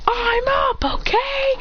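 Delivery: very high-pitched mock protest; indignant retort. A narrator's reading. Student talking back to his teacher, indignant retort.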